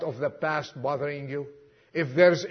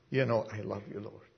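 soft humble reply; 9 semitones lower, 11 dB quieter, faster than usual. Retold or imitated. Peter answers Jesus, humble and hushed. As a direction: lower and much softer, as Peter.

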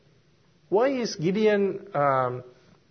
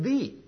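Thee lifted high and softer; single out the word Thee; stress on Thee; 7 semitones higher, 4 dB quieter than usual. Shown as a demonstration. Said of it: "He used a singular word".

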